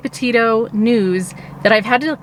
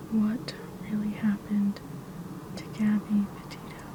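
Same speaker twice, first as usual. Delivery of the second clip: whisper; 14 dB quieter than usual. Real, not acted. Asking the app her intention.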